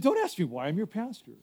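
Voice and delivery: a joking pastor refusing to explain, hushed. Retold or imitated. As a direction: play the bossy pastor, half joking. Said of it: "And I said".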